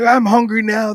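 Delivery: loud, high comic retort; mock protest. Joking retort to extroverted friend's wish.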